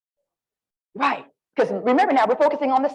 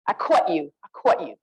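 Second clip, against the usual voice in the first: lower-pitched "caught" with a "kwaw" vowel, New Jersey style; New Jersey accent; 5 semitones lower than usual. Shown as a demonstration. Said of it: "I was born in New Jersey".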